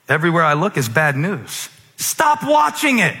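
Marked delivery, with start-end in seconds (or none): 2.0-3.2 much higher, slow, pointed command; high emphatic command. Acted. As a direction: snap them out of it. Preacher scolding the news watchers.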